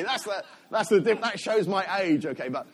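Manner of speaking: usual speaking voice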